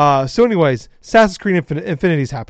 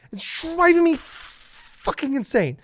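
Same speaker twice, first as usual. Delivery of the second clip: high, strained, slow exasperation; really mad; 10 semitones higher, slower than usual. Real, not acted. Host venting to co-host about fake interviews, really mad. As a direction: drag it out as if losing your mind. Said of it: "I'm really mad".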